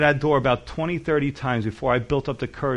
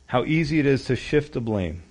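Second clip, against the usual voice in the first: ordinary delivery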